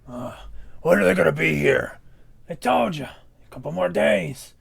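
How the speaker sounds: loud, gruff orc grunt; brutish orc voice. Acted. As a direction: bark it like a bored brute.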